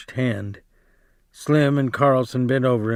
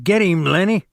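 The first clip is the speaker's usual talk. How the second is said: much higher, louder yell; 9 semitones higher, 4 dB louder than usual. Acted. George yelling at Lennie to fight back, high loud yell. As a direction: shout him on across the room.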